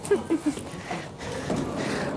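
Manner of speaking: stifled laugh. Real, not acted. Stifled laugh after leaving.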